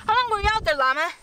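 ordinary delivery

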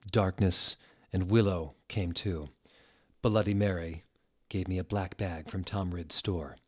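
ordinary delivery

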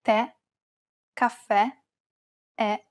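"Tè, caffè, è" with an open, stressed final E; "Tè", "caffè", "è", each ending on stressed open E. Shown as a demonstration. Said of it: "pronounce it as an open E".

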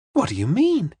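put-on high-pitched, startled young woman; startled cry. A narrator's reading. Young woman questions the detective, a startled high cry. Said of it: "she cried".

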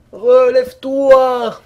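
long, loud, slow call; drawn-out shout. Real, not acted. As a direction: stretch it out, loud. Urging the fallen character up.

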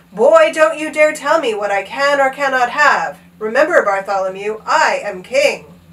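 loud, commanding storybook king; stern royal anger. A narrator's reading. King putting his page boy in place, angry. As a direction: pull rank, slowing on the last words.

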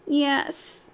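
soft, small sniffling yes; a quiet little sniff of a yes; sniffly yes. A narrator's reading. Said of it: "Whoopsie sniffed".

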